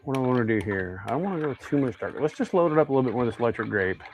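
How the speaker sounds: usual speaking voice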